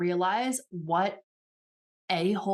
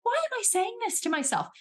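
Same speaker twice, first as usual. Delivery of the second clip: catching yourself; 10 semitones higher than usual. Retold or imitated. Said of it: "you start to go".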